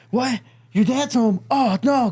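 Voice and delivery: high-pitched mock panic; freaking out. Friend learning dad's home, mock panicked yelp. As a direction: voice climbing in retold alarm. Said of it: "started freaking out".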